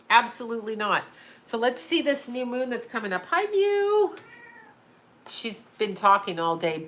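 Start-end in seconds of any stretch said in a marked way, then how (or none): 3.3-4.2 high, singsong hello to a pet; sweet high-pitched pet talk; pet-greeting voice. Real, not acted. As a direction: coo it way up high, stretching the name.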